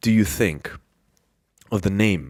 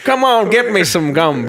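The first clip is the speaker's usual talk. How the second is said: higher, hammed-up Southern voice; Southern accent; 7 semitones higher, 4 dB louder than usual. Cohost falling back on gumbo gag, Southern accent. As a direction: belt out a playful Southern demand. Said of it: "I love that gumbo is your default".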